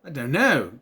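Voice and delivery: imitated British accent; short retold reply in mock British. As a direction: mimic a kid putting on a British accent. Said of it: "a British accent for some reason".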